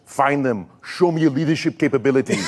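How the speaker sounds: imitated Austrian-accented order; Arnold Schwarzenegger. Star ordering a young assistant, Austrian accent. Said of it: "And he goes".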